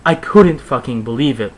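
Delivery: ordinary delivery, nothing marked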